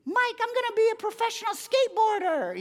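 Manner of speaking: very high, loud squeaky boast; boy telling the pastor his dream, high and squeaky; eager kid voice. Retold or imitated. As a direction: announce your big dream like a thrilled little kid. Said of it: "he said".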